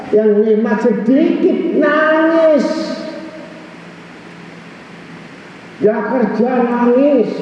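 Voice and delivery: high, ringing, half-sung sermon cadence; impassioned preaching. Acted. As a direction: plead with the congregation, voice lifted and chanting. (khotbah berapi-api dengan nada tinggi setengah dilagukan)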